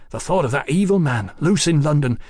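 horrified outburst. Acted. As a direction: pour out mounting horror. Mallory breaks in, horrified.

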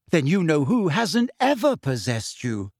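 ordinary delivery

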